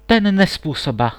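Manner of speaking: exclaiming. A narrator's reading. Princess on hearing the swineherd's price, indignant exclamation. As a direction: call it out in indignation. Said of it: "zvolila princezna".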